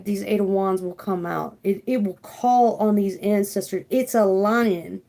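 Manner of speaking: ordinary talk, nothing marked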